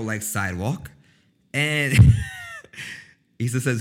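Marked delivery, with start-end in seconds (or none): 2.0-3.0 loud high burst of cackling; loud cackle. Real, not acted. Cracking up at a viewer's joke, loud high cackle. Laughs reading chat joke.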